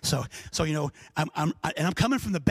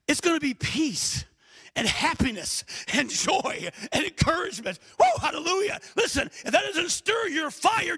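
full-force preaching; 10 semitones higher than usual. Acted. Preacher tells his church God loves them, full-force preaching. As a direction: build the sermon to a shout.

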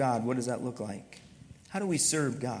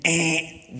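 loud "wrong answer" buzzer; buzzer noise; 4 dB louder than usual. Pretend. Preacher rejecting a common teaching, mock buzzer. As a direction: imitate a game-show buzzer, abrupt and loud.

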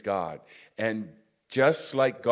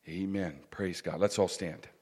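ordinary talk, nothing marked

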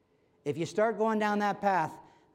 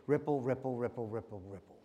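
each ripple softer and lower, dying away; ripple, ripple, trailing off to nothing; fading ripples; 6 semitones lower, 4 dB quieter than usual. Shown as a demonstration. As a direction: let the word fade out like rings on water. Pebble ripples spreading in calm water.

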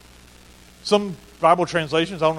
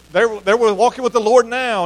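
mock platitude; 5 semitones higher, 8 dB louder than usual. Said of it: "some spiritual platitude like".

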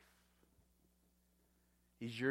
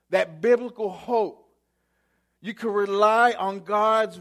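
rising, louder preacher's build with the last words slowed; preaching; 4 semitones higher, 6 dB louder than usual. Acted. Pastor assuring the church nothing can hurt them, full preaching. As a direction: preach it with growing conviction.

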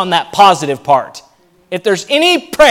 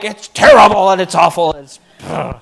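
mock gloomy complainer; 3 semitones lower, 3 dB louder than usual. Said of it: "they're like".

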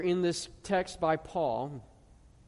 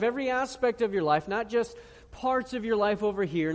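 ordinary delivery

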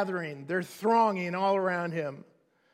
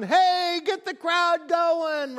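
higher, louder call down the hill, slowing on the end; yelling down; 8 semitones higher, 5 dB louder, slower than usual. Retold or imitated. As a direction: shout down from the mountaintop to the crowd. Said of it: "they're yelling down".

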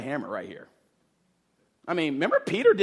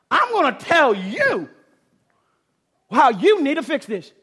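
arrogant demand; 7 semitones higher, 5 dB louder than usual. Retold or imitated. Martha ordering Jesus around, high bossy demand. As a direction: tell Jesus what to do, smugly. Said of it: "she's arrogant".

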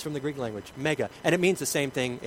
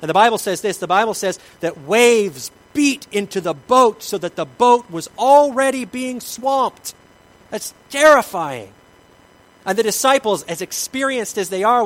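preaching at full force; 6 semitones higher, 11 dB louder than usual. Acted. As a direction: thunder the danger of the swamped boat.

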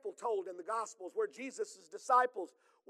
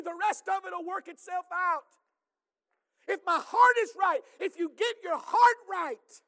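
rising, louder preaching voice that builds to a shout; full-force preaching; 7 semitones higher, 8 dB louder than usual. Acted. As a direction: preach it out to the congregation. Preaching climax.

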